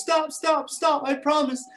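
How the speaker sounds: high, loud pleading; begging lion. Acted. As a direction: beg for mercy, high. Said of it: "finally the mountain lion goes".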